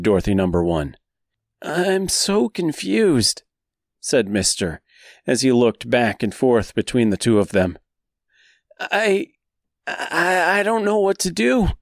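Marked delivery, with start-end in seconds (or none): 1.6-11.7 lost and stammering; confused. Acted. Mister torn, confused.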